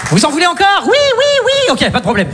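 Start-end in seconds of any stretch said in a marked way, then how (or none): 0.8-1.5 high squeaky crowd cheer; much higher excited yes yes yes; fake audience. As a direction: play your own eager audience. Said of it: "je fais aussi mon propre public".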